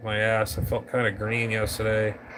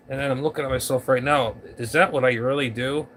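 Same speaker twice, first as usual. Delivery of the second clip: ordinary talk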